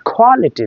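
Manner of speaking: quality with a puffed opening, like খ; breath on the first sound of quality; aspirated K. Shown as a demonstration. Teacher stating the correct form, aspirated quality. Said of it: "Correct pronunciation is".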